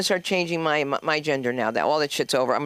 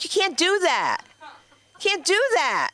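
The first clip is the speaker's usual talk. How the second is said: shocked protest; 12 semitones higher, slower than usual. Retold or imitated. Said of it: "I was like".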